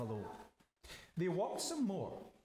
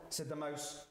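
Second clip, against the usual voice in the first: ordinary delivery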